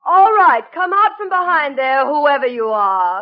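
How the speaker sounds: stern summons. Acted. Woman ordering a hidden intruder out, loud summons. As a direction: call out the intruder loudly.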